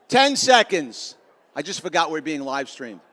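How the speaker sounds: ordinary delivery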